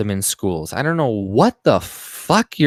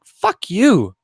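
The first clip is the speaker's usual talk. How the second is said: sharp higher, louder fuck-off; angry retort; 8 semitones higher, 5 dB louder than usual. Real, not acted. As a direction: snap back at the school statement. Retort to school board statement.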